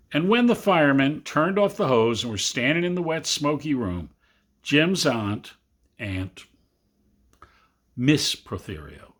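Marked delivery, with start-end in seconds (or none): none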